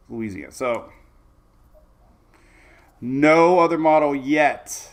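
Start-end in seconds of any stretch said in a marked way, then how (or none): 3.0-4.9 the word yet leaned on, drawn out and louder; stress on yet. Real, not acted. Weather vlogger insisting no model shows it yet, stressing yet. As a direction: hold and punch yet so it lands. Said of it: "I wanna stress the word yet".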